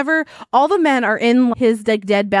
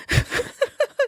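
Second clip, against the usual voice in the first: high giggle; 4 dB quieter than usual. Real, not acted. Laughing at worm flop.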